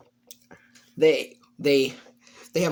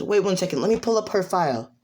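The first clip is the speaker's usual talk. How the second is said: high-pitched, quick official; high, busy character voice; Nezu; 7 semitones higher, faster than usual. Acted. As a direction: hurry through it in a high voice. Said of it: "and he says".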